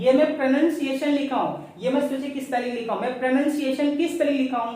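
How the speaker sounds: ordinary delivery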